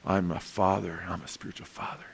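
low, hushed mock boast; self-important title. Someone flaunting spiritual fatherhood as a title, mock self-importance. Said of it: "as a title".

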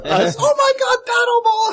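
high, loud excited squeal; excited shout. Acted. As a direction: shriek with glee at the find. Spots paddleball in the store.

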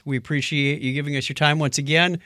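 ordinary delivery

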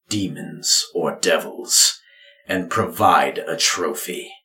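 grave ritual pronouncement; solemn father. Acted. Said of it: "your father speaks".

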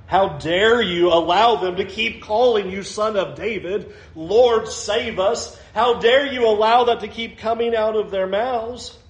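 usual speaking voice

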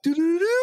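high sung sparkle jingle; twinkly magic sparkle. Retold or imitated. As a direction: imitate a tinkly magic chime. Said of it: "magic Princess Sparkle sound effect".

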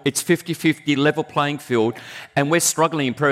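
ordinary delivery

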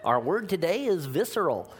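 a lightly put-on radio teacher voice; hint of a cheesy language-course host; vocabulary course announcer. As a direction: announce the word of the day like an ad. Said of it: "a little verbal advantage course".

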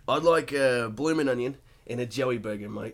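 Australian accent. Shown as a demonstration. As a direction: order it like an Australian soap character. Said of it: "in an Ethan Lovett voice".

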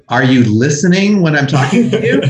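high, loud scolding rant at a bad driver; hammed-up exasperated yelling, breaking into a laugh; mock furious back-seat driver; nagging angry GPS. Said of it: "it doesn't go".

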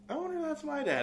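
a son suddenly suspecting it's his father; dawning surprise. Retold or imitated. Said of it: "and he's like".

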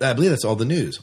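normal talking voice

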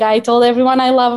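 ordinary delivery

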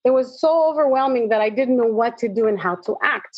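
ordinary talk

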